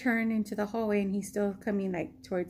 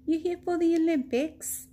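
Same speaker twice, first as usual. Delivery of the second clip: higher, lightly voiced lady asking; curious helper lady; 7 semitones higher than usual. Helper lady asking the tourist about the Olympics, higher. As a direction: friendly quiz from the helper. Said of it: "And she goes".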